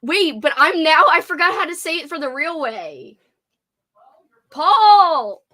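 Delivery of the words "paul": calling out. Real, not acted. Calling to Paul in house.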